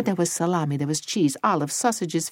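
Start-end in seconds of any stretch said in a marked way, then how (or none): none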